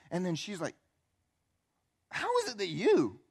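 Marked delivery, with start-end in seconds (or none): 2.1-3.2 irritated tone. Acted. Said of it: "can you understand the tone".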